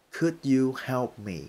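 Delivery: slow, careful "Could you help me?"; slow demo. Shown as a demonstration. Teacher showing how to ask for help slowly. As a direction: model it slowly. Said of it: "thì bạn sẽ hỏi là".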